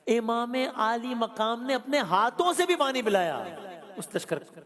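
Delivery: high, loud and slow, each word weighted; slow loud preaching. Real, not acted. Preacher to congregation, slow loud emphasis. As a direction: lift the hall with it. Preacher stressing Imam's own hands.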